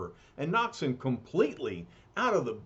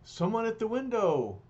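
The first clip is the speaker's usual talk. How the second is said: lightly voiced young woman; 7 semitones higher than usual. Blind Alicia voiced high.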